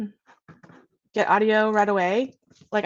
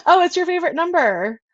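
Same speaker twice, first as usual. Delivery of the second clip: bright laugh through the words, higher and louder; delighted laughing realization; 9 semitones higher, 6 dB louder than usual. Real, not acted. Realizes the friend chose her number.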